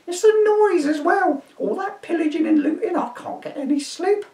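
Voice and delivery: put-on high shrieking old woman; an indignant old dear shooing a Viking; old lady to constable, high and indignant; crone voice. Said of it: "my crone voice".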